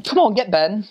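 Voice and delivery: high, calling Scottish brogue; Scottish accent. Acted. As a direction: usher someone in with a Scottish lilt. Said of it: "or they say".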